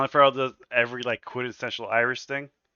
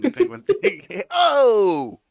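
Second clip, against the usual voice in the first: high, loud mobster yelp; Penguin yelp; 14 semitones higher, 6 dB louder, much slower than usual. Retold or imitated. Penguin yelping at Batman in a high mobster yelp. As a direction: yelp like a startled gangster.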